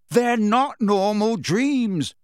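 slow, high, forceful shout. A narrator's reading. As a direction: shout it in her face, drawing out each word. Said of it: "Harry shouted in her face".

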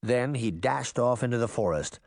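plain reading voice

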